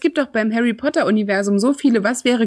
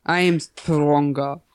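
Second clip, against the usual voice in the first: slow, drawn-out mock quote, lower pitch; imitierte Serienfigur; 5 semitones lower, much slower than usual. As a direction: quote it slowly in a put-on voice. Quotes series line, mocking teeth. (gedehnt und tief nachgeäfft)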